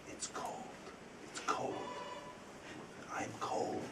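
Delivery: breathy whispered ghost voice, very soft; a ghost whispering from the wall; ghostly whisper. Retold or imitated. Ghost voice in told story.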